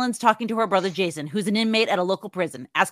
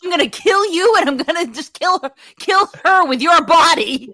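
quoting a furious threat; 9 semitones higher, 7 dB louder than usual. Retold or imitated. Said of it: "And then she goes".

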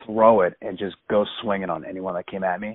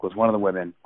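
ordinary talk

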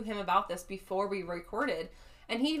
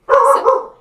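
loud exclamation; 17 dB louder than usual. Real, not acted. Dog barking interrupts.

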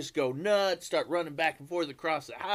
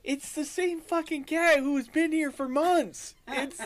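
exasperated owner; 8 semitones higher than usual. Retold or imitated. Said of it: "and you're like".

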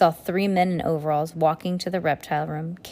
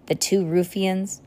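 plain reading voice

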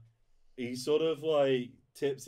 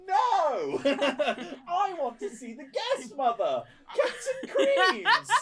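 high, loud yelling through laughter; laughing heckler; 8 dB louder than usual. Acted. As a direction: shout while cracking up.

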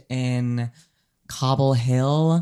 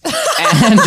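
laughing; 8 semitones higher, 5 dB louder than usual. Real, not acted. Laughing while telling pants story.

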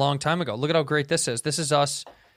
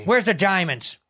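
raised, pressing question; robber's demand; 5 semitones higher, 3 dB louder, slower than usual. Acted. Robber hunting for loot, pressing demand.

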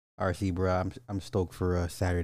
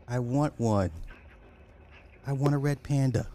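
higher, louder pleading want; wanting one; 6 semitones higher, 4 dB louder than usual. Real, not acted. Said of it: "Let's do a little A S M R".

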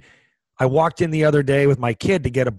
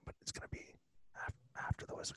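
hushed confiding whisper; 26 dB quieter, much faster than usual. Retold or imitated. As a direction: lean in and whisper it. Aside to old man, unvoiced.

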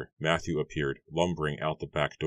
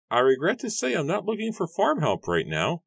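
much higher, put-on voice for the farmer; gruff farmer Matthew; 13 semitones higher, 3 dB louder than usual. A narrator's reading. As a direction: play the grumpy farmer, pitched way up. Matthew refuses the young man.

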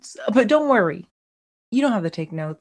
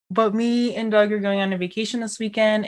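normal talking voice

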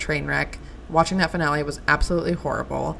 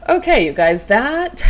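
ordinary delivery

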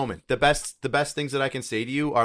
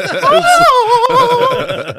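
shrieking burst of laughter; loud high laugh; 11 dB louder than usual. Real, not acted. Laughing about the creepy wake-up song, loud. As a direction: let out a big high laugh.

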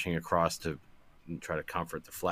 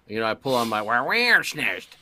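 ordinary talk, nothing marked

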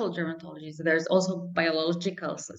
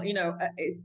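ordinary delivery

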